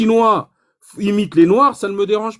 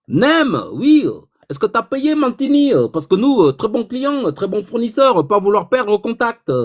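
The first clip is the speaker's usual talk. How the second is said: higher, broken French in a mock Chinese accent; put-on Chinese accent; 5 semitones higher than usual. Chinese buyer reporting the coffee delivery, in a mock Chinese accent. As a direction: play the Chinese caller in a broad put-on Chinese accent. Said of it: "Je fais l'accent chinois".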